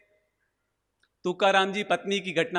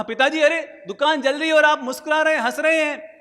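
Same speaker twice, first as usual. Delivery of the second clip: high, louder, fast and urgent; alarmed son; 7 semitones higher, 5 dB louder, faster than usual. Retold or imitated. Second son telling his father the shop burns, high and urgent. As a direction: rush the news out in alarm.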